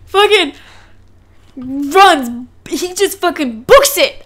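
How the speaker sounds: ordinary delivery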